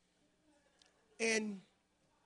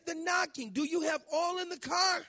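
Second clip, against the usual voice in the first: high, loud and urgent; alarmed shout; 8 semitones higher, 6 dB louder than usual. Retold or imitated. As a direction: shout the question at a clueless husband. Wife shouting about missing oil.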